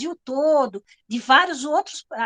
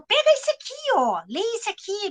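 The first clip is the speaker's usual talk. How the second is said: higher-pitched, eager imitation of someone pushing a book; recomendação animada; 8 semitones higher than usual. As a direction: act out an enthusiastic recommendation, pitched up. Garota animada recomenda um livro. (imitando uma recomendação animada, em tom mais agudo)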